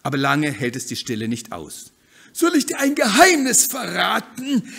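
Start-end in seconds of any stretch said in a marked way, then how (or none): 2.3-4.7 high, loud, bubbly hen voice; lively bossy hen. Acted. Hen eager to leave the backyard, loud and high. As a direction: push ahead full of cheer.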